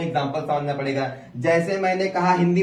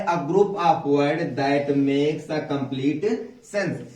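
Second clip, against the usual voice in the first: very slow, word by word; slow reading of the board; much slower than usual. Shown as a demonstration. Teacher reading the written definition slowly to pupils. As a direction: read the board aloud slowly. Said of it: "मैंने लिखा है".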